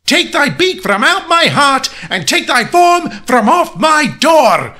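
high shriek, drawn out slow at the end; theatrical, desperate high shout. A narrator's reading. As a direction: shout the bird away at full pitch. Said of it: "I shrieked up starting".

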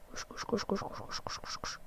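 breathless whispered chant to himself; whispered urging. Real, not acted. Contestant urging himself on during the water run, whispered chant. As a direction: whisper "koş" over and over under your breath.

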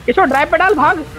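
high-pitched, repeated urgent orders; urgent commands. Real, not acted. Shouting driving orders to teammate. (ऊँची आवाज़ में हड़बड़ी भरा हुक्म)

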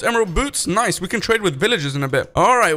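ordinary talk

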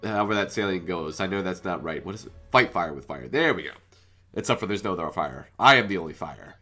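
normal talking voice

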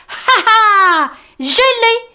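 high, loud laughing victory cry; triumphant Tom. Acted. Tom holding up the carrot, high triumphant laugh. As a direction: crow over the carrot. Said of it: "d'un air triomphant".

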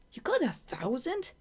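high, startled question; can't believe she beat you; disbelief. Acted. Shade hearing Marina won first, high startled disbelief.

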